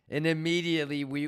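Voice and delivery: ordinary delivery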